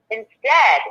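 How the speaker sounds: usual speaking voice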